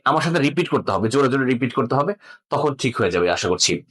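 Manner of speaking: ordinary talk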